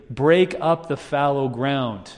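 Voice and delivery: slow, even read of the verse; slow scripture reading. Shown as a demonstration. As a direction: lay the verse down slowly. Verse first read slowly.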